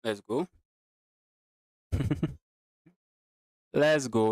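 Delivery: normal talking voice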